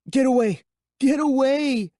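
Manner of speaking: high, loud desperate pleading. Acted. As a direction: beg her off in panic. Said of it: "I pleaded".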